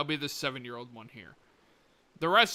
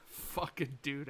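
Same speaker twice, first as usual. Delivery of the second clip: normal talking voice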